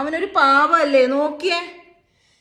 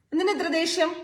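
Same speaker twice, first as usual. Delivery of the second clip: ordinary talk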